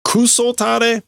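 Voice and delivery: high-pitched name-calling; sharp insults. Acted. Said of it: "getting sharper with their words".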